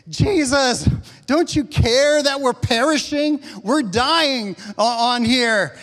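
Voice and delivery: loud, high cry for help; desperate plea. Acted. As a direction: cry out to be saved from drowning. Disciples cry out in storm.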